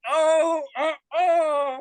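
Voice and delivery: stunned by the South-will-rise remark; shocked disbelief. Retold or imitated. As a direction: gasp at what he just heard, drawn out. Shocked retold reaction to racist remark.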